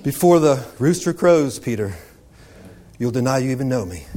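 soft, low, sad warning; quiet warning. Retold or imitated. Jesus quietly warning Peter, soft and low. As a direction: say it softly to Peter. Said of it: "Peter said".

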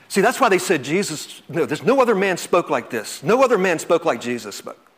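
normal talking voice

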